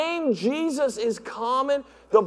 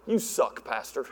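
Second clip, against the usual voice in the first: mock insult; 10 semitones lower, 6 dB quieter than usual. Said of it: "messages like".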